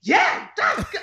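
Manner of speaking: very high, excited call from the crowd; dad blurting out praise in church. Retold or imitated. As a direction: voice the dad shouting approval mid-worship. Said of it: "all you hear ... is".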